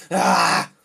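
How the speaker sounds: loud, low growled "ah"; angry wordless roar; frustrated yell. Acted. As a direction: let out a burst of frustration. Frustrated after storming off.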